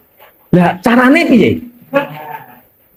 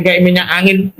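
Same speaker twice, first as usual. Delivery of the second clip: ordinary delivery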